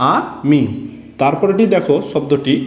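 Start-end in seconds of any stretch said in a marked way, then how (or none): 0.1-0.6 "আ মি" as two separate stretched sounds; broken into syllables. Shown as a demonstration. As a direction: say "আ মি" with a clear gap after আ. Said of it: "কিভাবে ভেঙে ভেঙে পড়ব". ("আ মি" ভেঙে ভেঙে টেনে পড়া)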